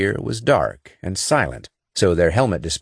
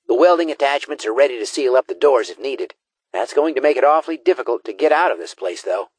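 raised-pitch tech specialist voice; higher character voice; 7 semitones higher, faster than usual. A narrator's reading. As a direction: speak as the squad's tech expert. Gee Whiz character voice.

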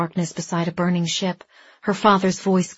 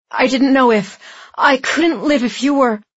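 loud, higher, desperate confession; distraught outpouring; 5 semitones higher, 6 dB louder than usual. A narrator's reading. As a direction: blurt it out, overcome with fear. Dex overwhelmed she is alive.

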